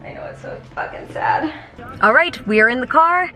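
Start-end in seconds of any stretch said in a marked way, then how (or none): 0.0-1.5 whispered sadly. Real, not acted. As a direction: whisper it under breath.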